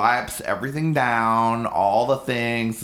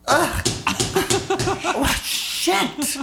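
panicked shriek; much slower than usual. Retold or imitated. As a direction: shriek in mock panic over the seat. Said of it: "She goes".

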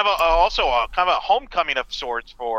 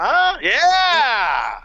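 excited; 13 semitones higher, 4 dB louder than usual. Real, not acted. Excited reaction to title idea.

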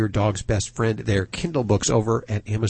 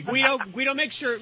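teasing; 11 semitones higher, 5 dB quieter than usual. Acted. Teasing Steve as Italian Guido.

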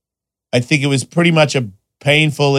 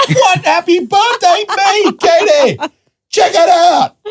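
very high, loud, slow gleeful cry; mock delighted shout; 5 dB louder, slower than usual. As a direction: crow with birthday delight, way up high. Retold delighted reaction.

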